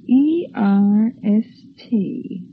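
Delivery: slow spelling out. Acted. Wife slowly spelling the word.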